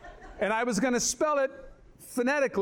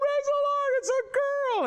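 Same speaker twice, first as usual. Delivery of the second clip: joyful cry. Retold or imitated. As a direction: cry it out like a delivery room crowd. Everyone celebrating the birth.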